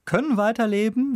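usual speaking voice

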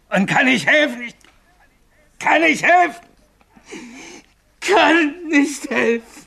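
agitated, climbing to a high cry; rising desperation. Acted. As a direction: break down in anguish, pitch climbing far up. Haunted man breaks down in despair. (zunehmend verzweifelt, fast weinend)

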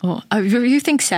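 posh British English, rising to high; British accent. Acted. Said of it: "kranken britischen Akzent hast".

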